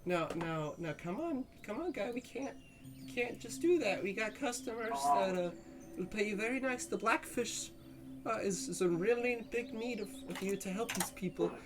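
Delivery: high, soft, wheedling sylph voice; pleading sylph. Pretend. Sylph begs drunk healer to help customers, soft pleading. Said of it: "He says".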